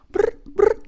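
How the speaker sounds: two short, loud trilled brrs; explosive double brr. Acted. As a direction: roll two loud bursts of brr, like a ringing phone. Trilled noise between catchphrase shouts.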